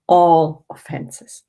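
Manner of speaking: "all offenses" slowly, leaning hard on "all"; "all" drawn out and pressed, as if in capital letters; stress on all. Shown as a demonstration. Urging the study group to forgive everything, stressing "all". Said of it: "all with capital letters".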